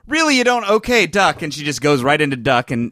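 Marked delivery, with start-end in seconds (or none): 0.1-1.4 mock surprise. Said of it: "We're like".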